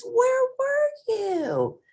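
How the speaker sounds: put-on very high, drawn-out child wail; squeaky kids demanding an answer; excited cry. A narrator's reading. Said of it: "cried all the kids".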